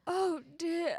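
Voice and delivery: high, breaking, overdramatic "Oh, dear"; mock melodrama from old Hollywood; dramatic old film actress. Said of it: "so dramatic".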